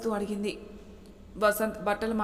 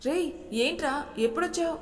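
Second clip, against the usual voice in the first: high, delighted greeting to a brother; light up on seeing him; happy surprise; 6 semitones higher than usual. A narrator's reading. Brother greets younger brother at the door, delighted and high. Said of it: "అంటూ ఆనందంగా".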